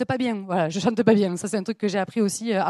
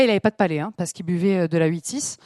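normal talking voice

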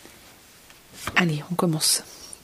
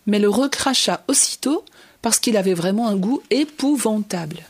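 plain reading voice

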